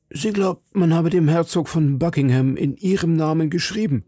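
worried citizen. Acted. Citizen confiding in D'Artagnan about the plot, lightly voiced worried citizen.

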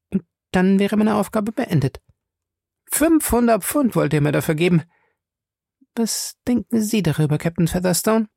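high-pitched woman recounting a scheme; Fay's character voice, serious. Acted. Fay tells Featherstone of Smith's plan, high and serious. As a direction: lay out the plot in a high lady's voice.

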